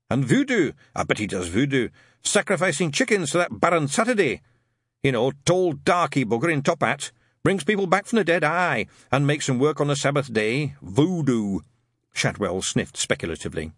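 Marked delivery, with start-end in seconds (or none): 0.1-11.6 put-on a suspicious old witchfinder ranting; gruff old sergeant. A narrator's reading. Shadwell ranting to Newt about his landlord, raised and gruff.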